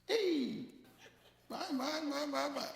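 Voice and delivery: high, giddy exclamations of delight; high excited squeals of joy; thrilled. Retold or imitated. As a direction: gush like a kid behind the wheel. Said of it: "I felt so excited".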